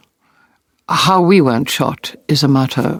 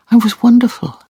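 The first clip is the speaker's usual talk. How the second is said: usual speaking voice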